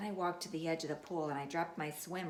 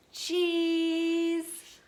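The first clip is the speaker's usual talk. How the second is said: cheese for photo; 10 semitones higher, 7 dB louder than usual. Acted. Posing for photo.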